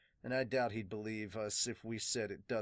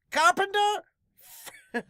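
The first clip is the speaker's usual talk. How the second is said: very high, loud hammed-up shipwright accent; Frankie-style accent; 15 dB louder, slower than usual. Introducing the carpenter loudly in a hammed-up accent. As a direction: shout it like a showman carpenter. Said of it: "imitate his, his accent".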